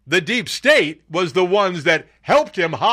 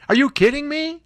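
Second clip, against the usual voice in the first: incredulous; 11 semitones higher than usual. Real, not acted. Host outraged at court ruling.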